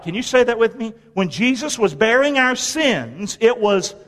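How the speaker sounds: ordinary talk, nothing marked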